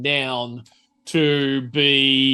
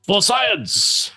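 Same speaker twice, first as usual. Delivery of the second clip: higher-pitched triumphant cheer; mock rallying cry; 7 semitones higher than usual. Game master urging the player to roll science, mock rallying cry. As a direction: proclaim it like a playful battle cry.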